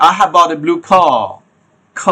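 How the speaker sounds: "car" as a long "kaa", no r; British silent r. Shown as a demonstration. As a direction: say "car" drawn out with the r dropped. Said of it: "এটা বলবে". ("car" শেষের র ছাড়া, টেনে)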